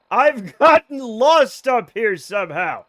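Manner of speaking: real laugh breaking through, slow and loud; half-laughing disbelief. Real, not acted. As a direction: laugh at your own wrong turn. Lost in the woods, laughing.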